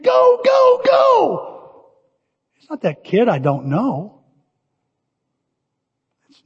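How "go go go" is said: cheering shout. Retold or imitated. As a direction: yell it like a proud grandpa. Grandpa cheering at soccer game.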